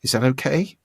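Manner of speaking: tentative upward lilt on "Is that okay"; questioning intonation. Shown as a demonstration. Coach voicing the weak speaker's hidden question, rising questioning intonation. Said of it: "That's called questioning intonation".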